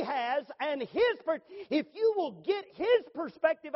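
preacher pressing his point hard, slow and loud; fervent preaching. Acted. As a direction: drive each phrase home from the pulpit. Pulpit preaching, building intensity.

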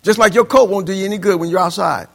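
ordinary delivery

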